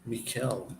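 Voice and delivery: "Mikel" said mee-KEL; "Mikel" with an ee first and a stressed KEL; Mikel pronunciation. Shown as a demonstration. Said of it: "it's pronounced Michael".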